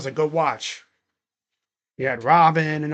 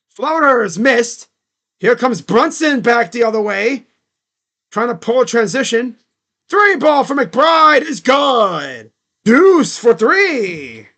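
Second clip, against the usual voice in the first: loud, high, drawn-out scoring call; sportscaster stretching out the big moment; excited play-by-play; 5 semitones higher, 7 dB louder, slower than usual. Real, not acted. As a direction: build to a shouted basket call. Calls the game live.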